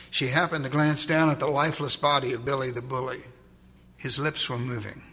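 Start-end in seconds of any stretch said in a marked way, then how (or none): none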